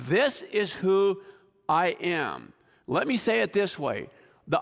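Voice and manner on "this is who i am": slow, weighty, each word set down; high, deliberate "This is who I am"; firm declaration. Pretend. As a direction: declare it with no room for debate. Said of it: "he's going like".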